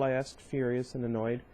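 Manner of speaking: ordinary delivery, nothing marked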